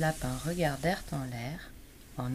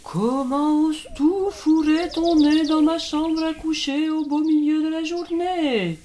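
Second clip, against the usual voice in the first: cri aigu et fort, outré; hibou très fâché; 9 dB louder than usual. A narrator's reading. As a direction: hibou furieux qu'on réveille. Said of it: "le hibou très fâché". (le hibou furieux gronde l'ourson)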